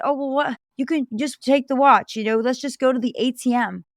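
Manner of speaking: mock a pushy vendor coaxing; pushy street vendor. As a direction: scam vendor talking her into a sale. Scam vendor pushing a sale.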